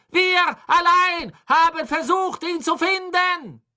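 very high, loud, fanatical tirade; shouted defiance. A narrator's reading. Condemned woman follower shouts up at Crouch, defiant cry. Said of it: "sah zu Crouch auf und rief".

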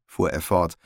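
usual speaking voice

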